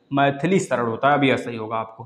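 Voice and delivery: ordinary talk, nothing marked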